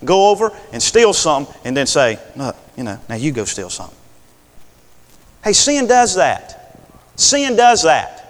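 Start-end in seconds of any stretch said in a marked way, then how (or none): 2.3-4.0 softer, conspiratorial; whispered-ish coaxing; a hint of a sly accomplice passing it on; sly low aside. Voicing a thief passing blame.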